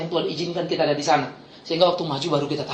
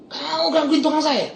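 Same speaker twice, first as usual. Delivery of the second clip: high-pitched confronting challenge; snapped scolding; 9 semitones higher than usual. Retold or imitated. Pastor confronting a thug over his workman, acted scolding. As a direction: pounce on him with the question. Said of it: "Langsung saya bentak".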